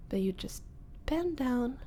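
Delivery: ordinary delivery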